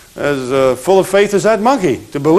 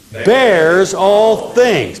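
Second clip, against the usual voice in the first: slow recitation; 3 semitones higher, much slower than usual. Shown as a demonstration. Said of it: "Love does what?".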